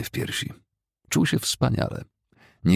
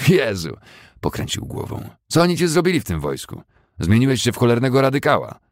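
loud, much higher scornful scoff; scornful disbelief; 8 semitones higher, 5 dB louder than usual. Acted. Fessenden mocking Billy as a radical, scornful loud. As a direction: scoff at him. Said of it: "prychnął pogardliwie".

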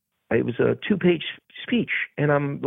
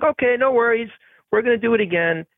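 high, sing-out reassurance; retold director; 12 semitones higher than usual. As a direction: imitate a director calling for another take.

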